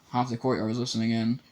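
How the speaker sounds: ordinary delivery, nothing marked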